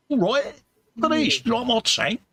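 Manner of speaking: high-pitched mock Cockney Russian; Cockney Russian accent. Host voices the happy little tank figure, Cockney Russian accent. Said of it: "In a very, in a very Cockney Russian accent there".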